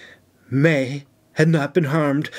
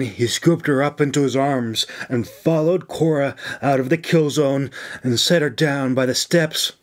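ordinary delivery, nothing marked

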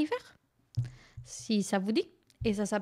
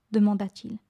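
usual speaking voice

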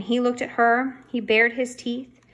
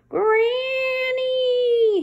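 high, loud, drawn-out screech of the name; screaming raccoon; 5 dB louder than usual. A narrator's reading. Raccoon screaming at Francine on the roof, high drawn-out screech. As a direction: shriek it long and high like a wild animal. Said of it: "he screamed".

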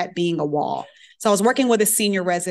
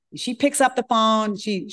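ordinary talk

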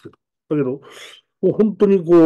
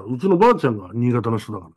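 normal talking voice

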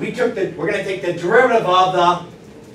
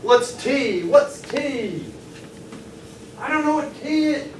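high-pitched mock-helpless voice; mock stumped student; 9 semitones higher, slower than usual. Teacher teases class not to be stumped, mock helpless voice. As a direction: wail it high like a baffled pupil.

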